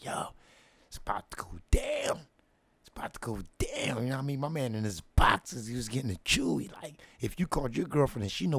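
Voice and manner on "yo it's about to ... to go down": hushed, secretive "it's about to go down"; conspiratorial whisper. Retold or imitated.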